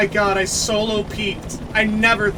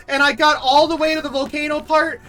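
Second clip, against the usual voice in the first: high, louder rush of joy; giddy excitement; 7 semitones higher, 3 dB louder than usual. Real, not acted. Streamer to chat about the failed earlier attempt, giddy high rush. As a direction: gush about the long-awaited win. Streamer gushing after the win.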